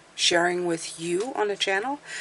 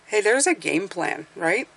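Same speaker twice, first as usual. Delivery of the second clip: normal talking voice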